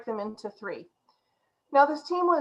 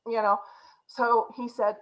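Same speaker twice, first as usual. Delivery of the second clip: usual speaking voice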